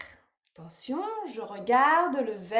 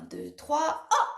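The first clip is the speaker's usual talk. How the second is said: ordinary talk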